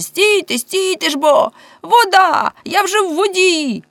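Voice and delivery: very high, alarmed calling; shouted from the well. A narrator's reading. Man reaching water in the well, high urgent cry. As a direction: cry up urgently to those above. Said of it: "крикнув він з колодязя".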